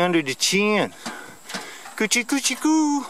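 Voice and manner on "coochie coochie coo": put-on baby talk. Tickling turtle, baby talk.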